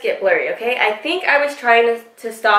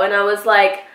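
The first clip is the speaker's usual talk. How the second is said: ordinary delivery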